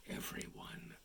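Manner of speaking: hushed suspense whisper. A narrator's reading. Narrator whispering to the children before the reveal. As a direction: breathe it to build suspense. Suspense in read-aloud story.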